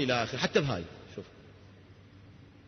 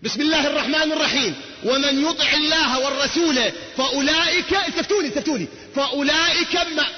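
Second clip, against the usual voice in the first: high, ringing verse call; preacher rousing the hall; fervent preaching; 5 semitones higher than usual. Acted. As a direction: demand the crowd's attention. High preaching, calls attention.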